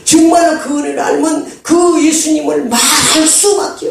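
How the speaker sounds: high-pitched pulpit cry; impassioned preaching. Real, not acted. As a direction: press the conviction home in a high voice.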